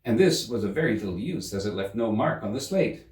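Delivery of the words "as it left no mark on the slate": ordinary delivery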